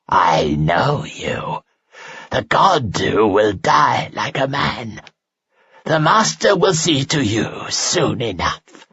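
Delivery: slow rasping creature voice, whisper then shout; monster taunt. Acted. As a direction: taunt him with a gurgling menace. Dying monster taunting Guy.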